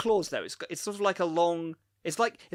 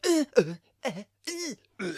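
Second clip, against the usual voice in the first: short high grunts of effort; string of mock fight noises; imitated grunts; 9 semitones higher than usual. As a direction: mimic a scuffle in little grunts. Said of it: "there's just a lot of".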